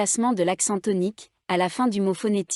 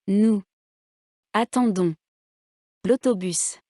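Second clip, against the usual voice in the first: Nous, attendons, l'autobus each stressed alone, long pauses between; word by word. Shown as a demonstration. Teacher showing written words one at a time, word by word. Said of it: "Faites attention à l'accent tonique".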